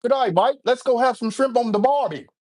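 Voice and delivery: high-pitched mock Australian accent. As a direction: put on a hammy Aussie voice, pitched high. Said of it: "I got an Australian accent too".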